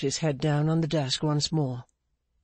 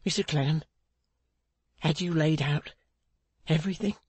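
hesitant question, breaking before "everything"; halting, with difficulty. A narrator's reading. Pancks asking Clennam about his money, halting with difficulty. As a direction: pause before "everything", then force it out as a rising question. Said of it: "brought out the last word itself with great difficulty".

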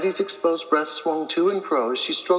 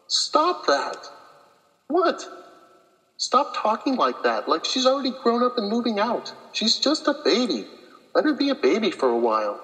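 higher, slightly sharp protest, tired partner; Cheryl protesting; 7 semitones higher than usual. Acted. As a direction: push back half-annoyed, half-tender, in a higher voice.

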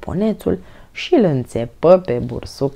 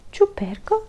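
high questioning tone; puzzled surprise; 12 semitones higher than usual. A narrator's reading. Mother puzzled by the nickname, surprised. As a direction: wonder at the odd word. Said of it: "se miră mama".

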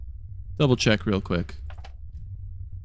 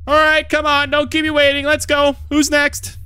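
impatient calling out; 8 semitones higher than usual. Acted. Hurrying visitors in.

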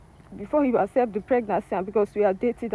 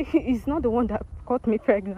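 raised-voice confession; louder. Real, not acted. Woman told by host to repeat her confession, louder. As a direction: say it up and out so it carries. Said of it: "Say it louder".

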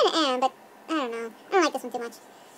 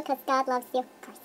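ordinary talk, nothing marked

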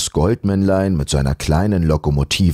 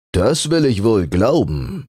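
lightly voiced fur; 6 semitones higher than usual. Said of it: "meinte der Pelz".

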